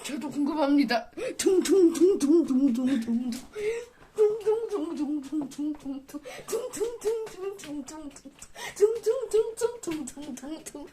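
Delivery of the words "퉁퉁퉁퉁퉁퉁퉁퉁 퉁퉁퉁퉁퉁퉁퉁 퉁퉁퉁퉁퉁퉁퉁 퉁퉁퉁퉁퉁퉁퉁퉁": sung-out tung tung bounce sounds; bouncing-ball chant. Pretend.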